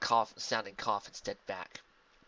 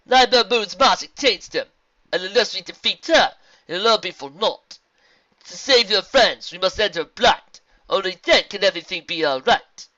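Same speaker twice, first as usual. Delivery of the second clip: solemn zebra; 8 semitones higher, 13 dB louder than usual. Acted. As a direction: declare it with measured weight. Zebra explains in rhyme.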